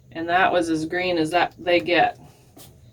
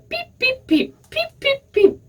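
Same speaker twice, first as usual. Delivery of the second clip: high chick beeps. Acted. Duckling's peeping keeps Farmer Brown awake.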